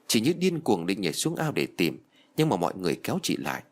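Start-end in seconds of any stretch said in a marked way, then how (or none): none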